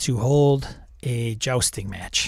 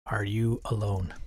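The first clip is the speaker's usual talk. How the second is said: quiet; 4 semitones lower, 10 dB quieter than usual. Acted. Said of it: "I ask the goblin".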